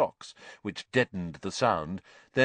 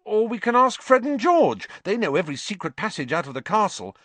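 loud, high burst, then quick; urgent high-pitched scheming; excited; 13 semitones higher, 6 dB louder than usual. A narrator's reading. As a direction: blurt out the bright idea. Ron urgently offers a plan.

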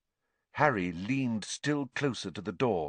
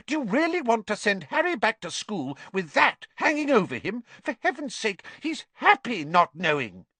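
high, anxious pleading mother; Mrs Weasley; 13 semitones higher, 5 dB louder than usual. A narrator's reading. Mother begging her husband, higher and louder. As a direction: plead to keep the boy unburdened.

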